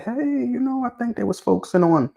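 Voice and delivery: high-pitched, casual suggestion; hypothetical commenter. Acted. Host voices someone guessing at the breeder's focus, high tentative quote. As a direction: start way up high and ease down, as if floating an opinion.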